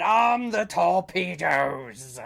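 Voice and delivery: loud, slow battle command; commanding order. Acted. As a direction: bark the order to the crew. Captain giving battle order.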